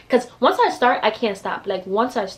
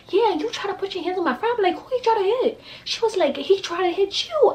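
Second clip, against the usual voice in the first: imitating the quick back-and-forth; 12 semitones higher than usual. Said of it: "she's like".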